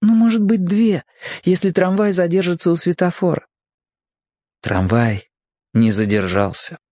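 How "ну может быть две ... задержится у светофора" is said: put-on high boy thinking aloud; boy's inner voice. A narrator's reading. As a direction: voice a boy's hopeful thought, pitched well up. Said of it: "подумал мальчик". (мысли мальчика, высоким голосом)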